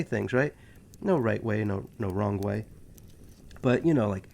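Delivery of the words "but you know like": normal talking voice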